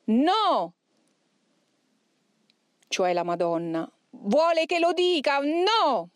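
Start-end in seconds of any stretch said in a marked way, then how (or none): 0.0-0.7 high, sharp cries of refusal; demon exclaiming. A narrator's reading. Demon resisting the exorcist's insistence, exclaimed refusal. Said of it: "cominciò a esclamare". 4.1-6.1 high, protesting demon voice; demon exclaiming. A narrator's reading. Demon answering the Madonna's demand, high protesting cry. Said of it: "cominciò a esclamare".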